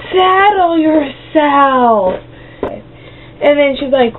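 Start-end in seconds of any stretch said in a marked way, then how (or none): 0.1-2.1 high, very slow drawn-out settle yourself; slow sassy order. Retold or imitated. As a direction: talk down to the cashier, syllable by syllable. Telling off cashier.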